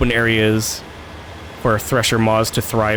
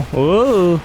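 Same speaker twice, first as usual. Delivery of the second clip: high, loud whoa; surprised; 7 semitones higher, 4 dB louder than usual. Real, not acted. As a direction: gasp it out in surprise. Landing on the high ground.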